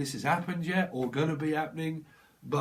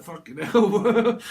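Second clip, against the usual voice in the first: laughing shock; 6 semitones higher, 10 dB louder, much slower than usual. Retold or imitated. Reaction to a dog attack.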